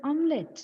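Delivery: omelette as OM-let; correct pronunciation. Shown as a demonstration. Teacher answering her own question, omelette correctly as OM-let. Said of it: "what is the correct pronunciation?".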